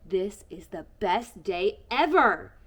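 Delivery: loud, slow joyful exclamation. Acted. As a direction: shout it with pure happiness. Eddie's joy sledding with dad.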